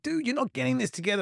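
high, fast mock snap at his son; retold snap. Dad on the phone snaps at his son, a retold high fast snap. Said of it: "every now and then I'll snap".

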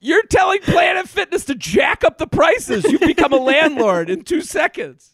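high, loud mock outrage; teasing shout. Real, not acted. Comedian teasing cohost for becoming a landlord, loud mock outrage. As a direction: yell the tease at your cohost.

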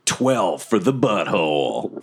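smooth low late-night radio host. Pretend. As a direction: purr it like a radio DJ. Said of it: "Sound like a radio D J".

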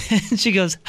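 ordinary talk

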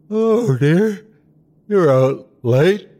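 sleepy, high voice stretched by a yawn; just woken, drag the words out. A narrator's reading. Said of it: "She yawned".

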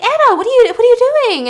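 high, loud surprised question; hammed-up astonishment; startled neighbour. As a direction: exclaim in alarm at a visitor. Startled neighbour opens the door.

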